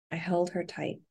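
plain reading voice

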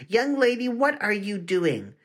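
angry lunchroom teacher scolds a student; scolding. A narrator's reading. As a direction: catch her red-handed. Teacher racing to the table.

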